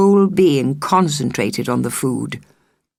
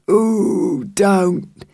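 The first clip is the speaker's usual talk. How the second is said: groaning; 5 semitones higher than usual. A narrator's reading. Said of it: "he groaned".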